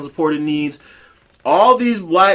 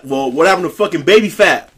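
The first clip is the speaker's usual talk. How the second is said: ordinary talk, nothing marked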